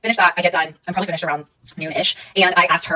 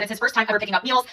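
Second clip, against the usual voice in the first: ordinary delivery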